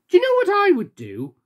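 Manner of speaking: a sunny friend eager to help; cheerful friend. Acted. Little Miss Sunshine gives advice.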